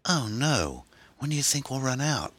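put-on high, anxious young passenger; worried. A narrator's reading. Passenger learning the tank is nearly dry, anxious. Passenger hears fuel is gone.

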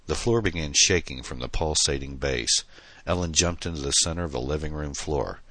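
usual speaking voice

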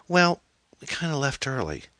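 put-on high, hesitant teenage voice; evasive daughter. A narrator's reading. Daughter admits leaving the game early, evasive and high. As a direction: draw out an awkward admission in a girl's voice. Daughter evades mother's questions.

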